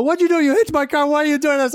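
mock outrage of the Mercedes owner, pitched high; angry driver. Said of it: "and he's like".

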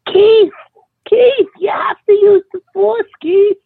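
shrill falsetto mom voice, way up high, crying shout; a mother wailing at her son in a sky-high screech; Kathy Baird impression. Friend imitates his buddy's mom nagging about the force, high crying shout. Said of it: "my Kathy Baird impression".

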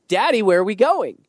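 light mock toddler asking daddy. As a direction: play the eager toddler running down the hallway. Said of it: "And she's like".

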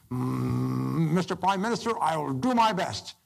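mock-reluctant officer, drawing out the words; imitating hesitant general. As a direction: stall with a hum, then answer slowly. Timid general answering Churchill's command.

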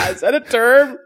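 high, loud question through laughter; incredulous, squealing with laughter; amused disbelief. Real, not acted. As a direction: ask it while cracking up. Laughing at the odd pronunciation.

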